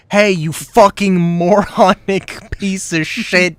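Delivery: loud hammed-up insults, laughing through; mock furious yell. Streamer voicing a rude contract client, mock furious yelling. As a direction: bellow abuse like a raging client. Acting out an abusive mercenary client.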